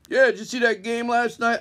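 jock doctor chat. Retold or imitated. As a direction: toss it off like a guy at a bar.

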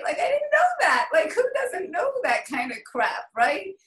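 high, loud laughing squeal at herself; laughing disbelief. Real, not acted. Speaker to her mentor Julie, admitting ignorance with a laughing squeal.

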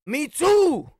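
high loud comic yell; shouted retort. Acted. Fed-up guy yelling at the kid's mom, loud. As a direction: bark it back at her, all in.

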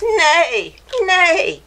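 mock horse whinny; horse neigh. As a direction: neigh like a pony for the kids. Imitating horse in children's story.

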